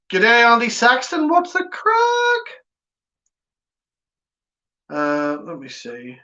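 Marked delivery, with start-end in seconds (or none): 0.1-2.5 high lightly put-on Australian greeting; a cheeky Aussie hello, pitched up; mock Australian accent, rising high at the end.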